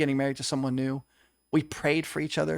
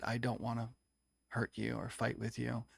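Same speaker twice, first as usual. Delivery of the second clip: much softer, a hint of tears; retold tearful plea; 3 semitones lower, 12 dB quieter than usual. Man pleading with his ex in the hall, softly and tearfully. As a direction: say it softly as if near crying. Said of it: "breaking down into tears".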